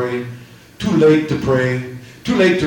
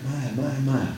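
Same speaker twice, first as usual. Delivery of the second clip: ordinary talk, nothing marked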